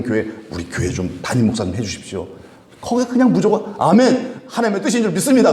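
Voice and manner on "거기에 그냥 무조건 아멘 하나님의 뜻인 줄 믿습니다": mock eager amen. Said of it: "그러고 이제 가버리는 거예요".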